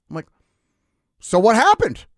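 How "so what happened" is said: demanding. Retold or imitated. Grandson demanding.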